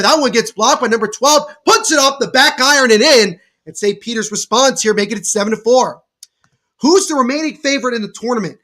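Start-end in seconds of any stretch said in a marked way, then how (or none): 0.0-1.5 brisk live game call, rising on the big plays; lively sports commentary. Acted. 1.6-3.4 excited call. Acted. Bank shot falls. 3.6-6.0 brisk live game call, rising on the big plays; lively sports commentary. Acted.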